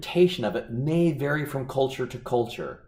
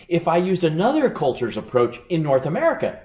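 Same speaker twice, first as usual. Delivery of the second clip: normal talking voice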